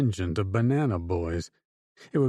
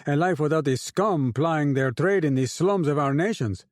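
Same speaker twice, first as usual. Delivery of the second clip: raised, orating to a crowd; rousing speech; 6 semitones higher than usual. A narrator's reading. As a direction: lift the voice to rally the men.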